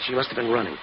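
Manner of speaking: ordinary talk, nothing marked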